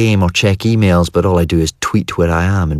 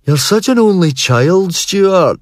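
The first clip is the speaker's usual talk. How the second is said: put-on high, louder jeering boy; mock yell; 12 semitones higher, 3 dB louder, slower than usual. A narrator's reading. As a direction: taunt him like a schoolboy. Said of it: "Ferg yelled".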